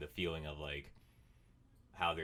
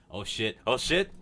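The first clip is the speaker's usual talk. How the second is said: mock panic; 5 semitones higher, 8 dB louder than usual. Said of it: "every day I was like".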